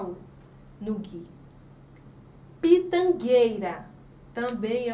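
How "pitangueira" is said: "Pitangueira" slow and loud, "guei" said as gei with no U; silent U. Shown as a demonstration. Teacher giving another digraph example, slow loud "Pitangueira" with silent U. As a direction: sound out "Pitangueira" firmly, hard g straight into ei. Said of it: "vai formar um dígrafo".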